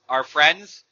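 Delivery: ordinary talk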